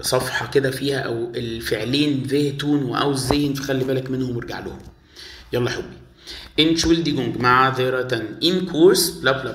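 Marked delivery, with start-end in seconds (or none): none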